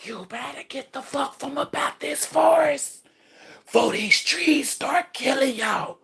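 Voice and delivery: eerie forest whisper. Retold or imitated. Trees whispering a warning to the witches, hushed and eerie. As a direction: hush it like the trees are warning you. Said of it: "you hear a whisper say".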